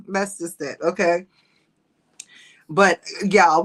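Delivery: ordinary talk, nothing marked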